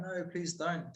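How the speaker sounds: mild retold panic of a scared mum; pleading mum. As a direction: quietly beg him not to fight. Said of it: "she's, like, freaking out".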